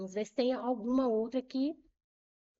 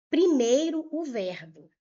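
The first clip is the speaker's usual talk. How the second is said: emphatic call; 2 semitones higher, 7 dB louder than usual. Shown as a demonstration. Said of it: "teacher stressing the first step".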